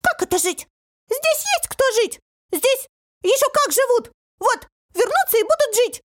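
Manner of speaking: very high, squeaky outrage; indignant cry. A narrator's reading. Hidden creature objects to the newcomers, indignant squeal. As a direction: protest in a tiny piercing voice. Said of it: "услышали возмущённый крик".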